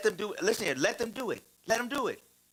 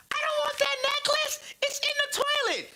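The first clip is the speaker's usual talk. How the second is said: put-on very high little girl voice, refusing; disgusted little girl. Daughter refusing the necklace from the toilet, very high and disgusted. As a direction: squeal it like a grossed-out small daughter. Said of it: "She's like".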